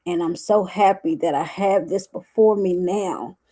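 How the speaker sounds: ordinary delivery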